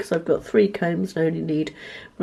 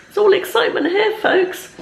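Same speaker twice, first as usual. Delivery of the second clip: mock excitement; 12 semitones higher, 3 dB louder than usual. Sarcastic aside about dull day.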